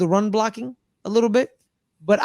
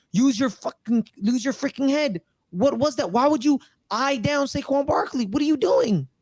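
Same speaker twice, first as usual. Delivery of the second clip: higher-pitched mock exasperation; imitated scolding; 6 semitones higher than usual. Coach telling off his quarterback, mock exasperated scolding. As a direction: imitate a coach demanding answers, voice rising. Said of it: "he's basically saying with Daniel Jones".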